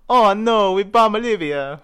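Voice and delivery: high, loud cartoon Italian plumber; hammed-up Italian game-hero cry; Super Mario Obama. Shown as a demonstration. Combining impressions for laughs, high loud Mario Obama. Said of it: "doing Obama Super Mario".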